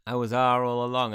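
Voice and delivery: full-volume villain-style unmasking; loud dramatic reveal. Acted. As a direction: belt out the big twist. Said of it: "comes at the last chapter and goes".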